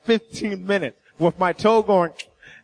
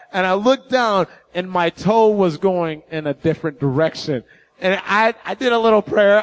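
normal talking voice

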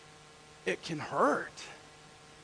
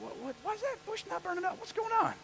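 high, softer startled questioning; baffled, pitched up; puzzled; 10 semitones higher, 4 dB quieter than usual. Acted. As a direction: wonder aloud at a strange sight. Acts puzzled Moses at bush.

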